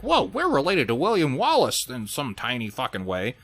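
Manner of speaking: mock boastful amazement. Everybody with Scottish heritage claiming Wallace kinship, mock amazed. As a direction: imitate everyone bragging about their heritage. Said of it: "Everybody with Scottish heritage is like".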